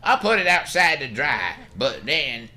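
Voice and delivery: put-on high, loud, put-upon old doctor grumbling; high-pitched cranky old man complaining about his debts; crusty doctor voice. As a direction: play him as a stingy old codger brushing off a visitor.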